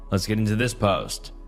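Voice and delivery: usual speaking voice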